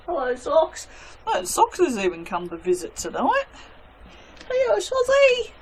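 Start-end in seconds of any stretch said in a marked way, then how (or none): 4.5-5.5 cooing at cat. Real, not acted. Greeting her cat.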